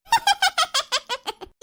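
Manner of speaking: high, falling giggle; laughing. Acted. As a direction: burst into a high teasing laugh. Teasing about lazy girls. (ഉയർന്ന ശബ്ദത്തിൽ പൊട്ടിച്ചിരി)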